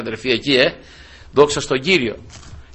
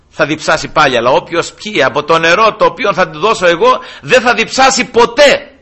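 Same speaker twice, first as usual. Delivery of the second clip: higher, louder proclaiming voice; Christ proclaiming; 6 semitones higher, 4 dB louder than usual. Acted. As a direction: declare it like a preacher. Jesus answers the woman, preaching.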